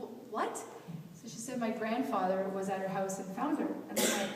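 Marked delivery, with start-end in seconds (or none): 0.0-0.6 high, halting, rising disbelief; retold shock. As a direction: stammer it as stunned disbelief, pitch up. Stunned reaction to news of death.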